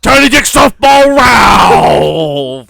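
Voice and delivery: monster truck ad shout. Pretend. Loud rally ad shout.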